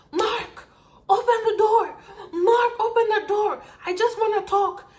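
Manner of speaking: high, loud shouting through a door. Retold or imitated. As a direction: yell like an ex demanding entry. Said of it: "she starts shouting to him".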